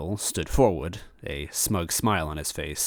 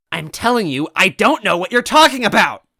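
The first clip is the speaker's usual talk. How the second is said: very high, loud desperate screech; 14 semitones higher, 10 dB louder than usual. Acted. Toadette screeching her denial at the villain. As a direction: scream the denial. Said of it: "Toadette screeched".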